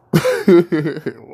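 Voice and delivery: high loud bitter laugh. Real, not acted. After losing his job, a loud laugh. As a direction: laugh it off loudly.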